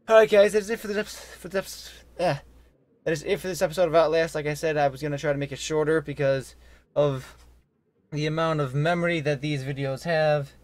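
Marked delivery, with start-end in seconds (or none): none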